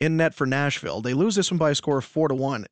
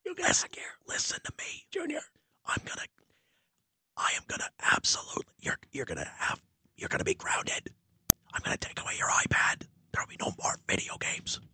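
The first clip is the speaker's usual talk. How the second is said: angry whisper, fast; a furious dad scolding under his breath; 13 semitones higher, 8 dB quieter than usual. Pretend. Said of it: "very intimidating dad last night".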